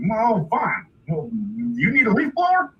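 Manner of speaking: high, grudging new offer; haggling hillbilly father. Retold or imitated. Dad offers a leaf blower, hillbilly haggling. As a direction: swoop up hopefully like a rube trader. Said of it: "And then he was like".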